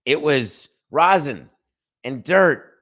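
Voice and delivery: a hint of an indignant player; lightly voiced ballplayer's innocent denial; mocking Diaz. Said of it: "Edwin Diaz, after the game, said".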